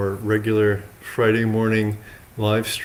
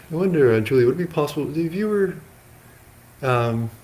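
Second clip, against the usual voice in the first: higher-pitched, tentative polite request; off-camera request; 7 semitones higher than usual. Real, not acted. Monk asks a lay guest off camera for help, higher-pitched request. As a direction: call over in a lifted voice to ask a favour.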